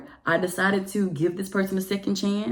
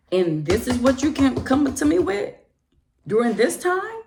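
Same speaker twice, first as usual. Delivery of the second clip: much higher, louder indignation; outraged; 7 semitones higher, 4 dB louder than usual. Real, not acted. Venting anger at the man's timing. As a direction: rise up in outrage.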